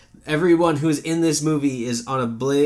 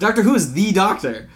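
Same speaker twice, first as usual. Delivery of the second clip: higher and louder, "the" stressed in "the Doctor"; emphatic THE; 6 semitones higher, 4 dB louder than usual. Real, not acted. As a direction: insist it's the one and only Doctor. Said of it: "you said it like the Doctor".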